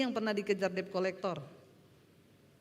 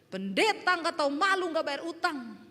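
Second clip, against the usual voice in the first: higher-pitched angry debt collector yell; loud "Hei", then a scolding shout; retold yelling; 4 semitones higher, 3 dB louder than usual. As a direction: imitate a collector yelling at a debtor. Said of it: "teriakin saya".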